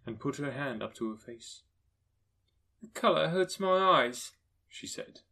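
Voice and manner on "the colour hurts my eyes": higher, slow and louder, pained; a tired mother hiding her sore eyes; mother's weary line. Acted. Mother to her children, pained and slow.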